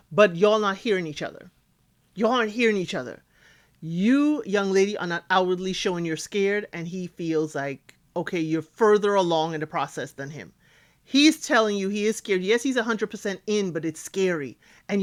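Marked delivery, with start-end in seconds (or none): none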